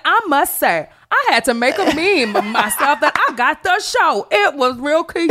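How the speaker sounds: high, smug, pleased with himself; smug bragging. Retold or imitated. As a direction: gloat about it playfully. Mocking rival's bragging.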